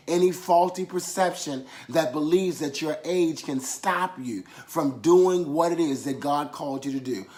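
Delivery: ordinary delivery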